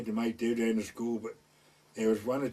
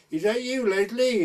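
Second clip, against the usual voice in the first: higher, louder call from a window; 9 semitones higher, 7 dB louder than usual. Retold or imitated. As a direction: call down to the street. Woman calling from bedroom window.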